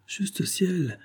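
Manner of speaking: sudden high exclamation, pulled back; a startled gasp of words; high startled outburst; startled cry. Acted. Dudley startled by the question.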